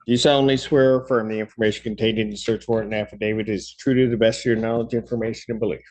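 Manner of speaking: fast, rattled-off oath; rote court oath. Real, not acted. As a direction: rattle it off from memory. Judge swearing in officer.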